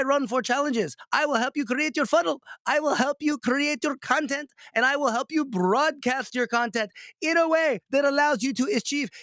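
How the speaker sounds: high-pitched, hammed-up Indian accent. Coach doing his joke sales pitch for a client, thick Indian accent. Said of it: "with this Indian accent".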